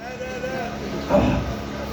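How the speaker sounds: long winded exhale, out of air; breathless sigh. Real, not acted. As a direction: let out a spent gasp of breath. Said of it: "I'm running out of breath".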